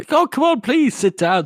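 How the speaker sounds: high, loud gushing invitation; eager welcome. Retold or imitated. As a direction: fuss over the guest. Arch Lector voiced warmly welcoming.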